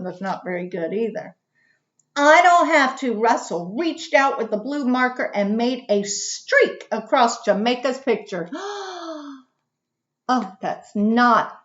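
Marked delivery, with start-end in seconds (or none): 2.2-3.1 put-on loud, higher stubborn child's retort; loud, stubborn boy's comeback; defiant reply. A narrator's reading. Russell defies Jamaica in story. 8.5-9.3 sharp, soft gasp of shock; quick startled intake of breath. Acted. Reacts to ruined picture.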